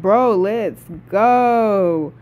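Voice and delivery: loud, long drawn-out hype cheer; hyped cheer. Retold or imitated. Fan replaying reaction to Atreus's height, hyped.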